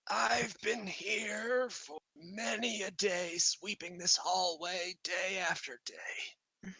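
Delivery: high, slow, soft creature voice; high-pitched kobold sweeper, drawn out; weary kobold voice. Acted. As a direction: drag it out like a long-toiling kobold.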